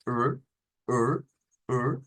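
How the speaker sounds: er with the tongue curled back; English-style R. Shown as a demonstration. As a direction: retroflex "er", each said separately. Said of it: "como recogiendo la, la lengua".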